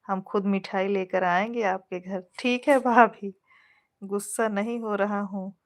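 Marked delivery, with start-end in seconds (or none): none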